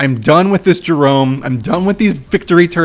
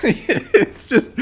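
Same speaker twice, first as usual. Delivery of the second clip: laughing; 5 dB quieter than usual. Real, not acted. Laughing at own trash talk.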